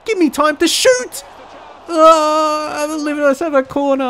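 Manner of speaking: high, loud real outburst; excited shouting. Real, not acted. Gamer wanting time to shoot late on, excited shouting. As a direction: yell as the late attack unfolds.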